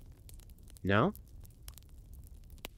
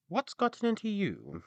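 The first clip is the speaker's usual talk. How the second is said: high, concerned donkey; donkey asking; 12 semitones higher than usual. Acted. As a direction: ask it as the kindly donkey. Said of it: "asked the donkey".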